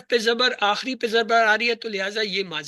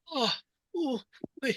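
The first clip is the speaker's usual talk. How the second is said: pained high groans of a sick man; higher, softer sick groans; sick man groaning; 5 semitones higher, 6 dB quieter, much slower than usual. Shown as a demonstration. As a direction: moan like someone ill. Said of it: "اس طرح کی آوازیں نکالیں".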